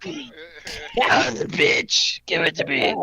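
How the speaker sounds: slow, strained monster voice; mock monster lover. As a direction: imitate a wrecked-voiced lover. Joking bedroom imitation of woman.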